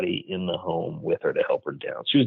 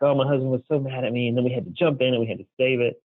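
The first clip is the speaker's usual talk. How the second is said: grandmother retelling her golf cart story to her grandson, half laughing, half crying, voice raised a little; a hint of tearful laughter, slightly higher; 5 semitones higher than usual. Laughing through tears, lightly imitated. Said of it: "crying and laughing at the same time".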